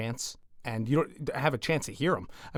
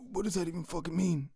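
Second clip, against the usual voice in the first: hushed, higher imitation of a raspy guy; 5 semitones higher, 5 dB quieter than usual. Co-host quoting the guy who challenged him, raspy. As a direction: quote him in a soft put-on rasp. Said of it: "He has that, like, raspy voice".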